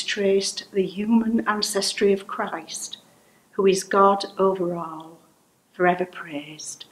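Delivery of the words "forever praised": ordinary talk, nothing marked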